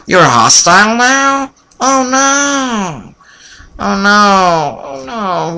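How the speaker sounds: high, wailing mock dismay; mock panic. Player finds the villagers turned hostile, mock panic. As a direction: play up the panic, drawing it out. Game characters suddenly turn hostile.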